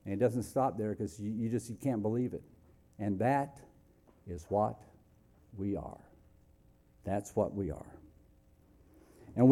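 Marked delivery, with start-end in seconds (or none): none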